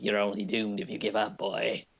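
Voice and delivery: lightly voiced mysterious old man; a hint of a crippled old stranger speaking up; disguised old man's voice. Quoting the voice behind Aladdin in the dungeon, lightly voiced old man. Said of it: "you hear this voice behind him".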